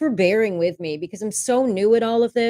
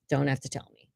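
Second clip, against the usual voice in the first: softer, lower echo of the line before; a softer aside to herself; quiet repeat; 4 semitones lower, 7 dB quieter, faster than usual. Real, not acted. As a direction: say "Don't have to tell me" again under your breath, softer and lower. Muttered repeat of previous line.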